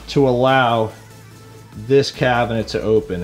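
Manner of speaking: ordinary talk, nothing marked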